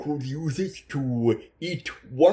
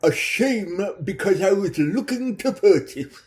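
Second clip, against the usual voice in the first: a touch higher, oily pimp voice; a hint of a greedy pimp making an offer; sleazy pimp; 5 semitones higher than usual. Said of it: "a Ponzi pimp".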